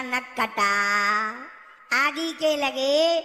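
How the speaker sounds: very slow, stretched mock address; drawn-out taunt. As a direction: drag out each phrase, holding the endings. Idol taunts the suffering stone. (खींच-खींचकर ताना मारते हुए)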